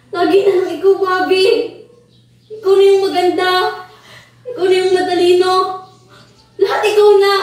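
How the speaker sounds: loud, hammering each "ikaw na"; jealous outburst. Acted. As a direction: spit out the resentment. (galit na selos, pasigaw)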